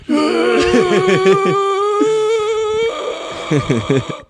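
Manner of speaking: long high retching gag noise; hammed-up dinosaur throwing up; vomiting. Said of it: "my impression of an Altosaurus throwing up".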